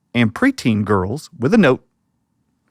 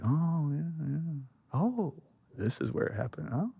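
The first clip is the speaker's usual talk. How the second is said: soft, higher knowing oh yeah; hushed knowing murmur; 5 semitones higher, 14 dB quieter than usual. Retold or imitated. Tour group murmuring knowingly, hushed. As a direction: murmur it like everyone's in on a secret.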